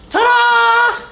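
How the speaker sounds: high, loud ta-da with a big grin; excited reveal. Real, not acted. Vlogger unveils his new Jordans to viewers, bursting with excitement. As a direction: show off the shoes like a magician's reveal.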